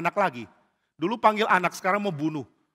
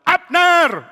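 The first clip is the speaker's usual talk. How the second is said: high, ringing shout; very loud summons; calling out; 14 dB louder than usual. Acted. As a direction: holler the name from far off. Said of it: "lalu ada suara Daud".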